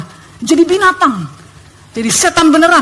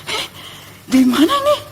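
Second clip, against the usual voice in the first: higher-pitched bewildered question; startled; 8 semitones higher than usual. Retold or imitated. Waking up in a roadside stall, startled. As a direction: ask it as if jolted awake. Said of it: "saya langsung kaget".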